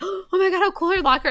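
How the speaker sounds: high, loud burst of delight; re-enacted squeal of excitement; excited gushing. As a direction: gush like a thrilled fan. Acts out her own excited first reaction.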